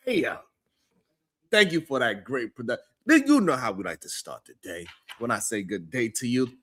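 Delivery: normal talking voice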